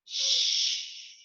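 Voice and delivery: drawn-out unvoiced "shh"; shushing sound. Shown as a demonstration. Teacher voicing the friend on the card, shushing. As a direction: hush someone with a long "shh". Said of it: "like my friend here is saying".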